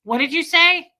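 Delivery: high, loud mock query; feigning bad hearing. Coaching a worker to annoy customers, feigned deafness. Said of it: "Act like you can't hear".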